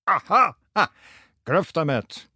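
high, triumphant "gotcha"; gleeful cry. A narrator's reading. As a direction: pounce on it, delighted. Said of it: "خیلی خوشحال داد زد". (فریاد خوشحالی)

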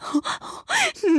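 high, acted sobbing; tearful sob. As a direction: sob aloud without words.

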